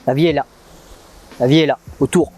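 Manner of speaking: loud and low, driving it home; loud emphatic. Real, not acted.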